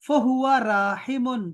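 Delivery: فہو عابدن stretched out very slowly; slow Arabic citation. Shown as a demonstration. Teacher modelling the doer form for Talha, very slow. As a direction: draw out فہو عابدن like a recitation. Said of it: "drawn-out Arabic model form".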